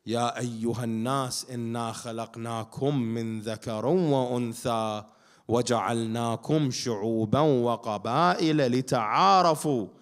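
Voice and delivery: ordinary delivery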